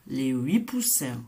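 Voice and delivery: plain reading voice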